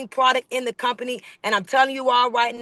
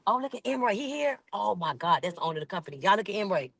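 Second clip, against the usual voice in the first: suddenly much softer, startled; surprised hushed aside; 2 semitones lower, 7 dB quieter, faster than usual. Real, not acted. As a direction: drop your voice as the boss appears. Owner unexpectedly joins the call.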